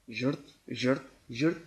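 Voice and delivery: rasping imitation of a saw on tin; grating saw noise. Preacher imitating a saw on tin, grating rasp. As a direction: make the ugly scrape of a saw cutting tin. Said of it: "eşeğin sesini bir ölçüyle yakınlaştırayım".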